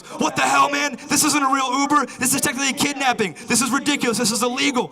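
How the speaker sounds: high, panicked mock outrage; freaked out. Passenger freaking out at the fake Uber driver, high mock outrage. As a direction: squeal it like a caught passenger. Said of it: "And he freaked out. He goes".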